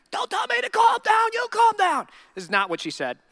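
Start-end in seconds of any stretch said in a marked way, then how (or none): 0.1-2.1 high, indignant mock yell; much higher comic outburst at being told to calm down; mock angry shout. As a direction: snap back like an offended bride. Mock Mary snapping at angel.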